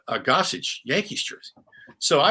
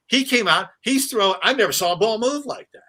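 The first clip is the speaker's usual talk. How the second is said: excited; 10 semitones higher, 6 dB louder than usual. Real, not acted. Excited retelling of lefty pitcher.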